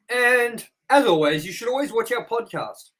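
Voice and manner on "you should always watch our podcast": ordinary delivery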